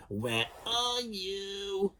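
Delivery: high, very slow calling voice; sister calling. A narrator's reading. Jemima calling for hidden Ned, high and slow. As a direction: call out into the dust. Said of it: "Jemima searching in dust".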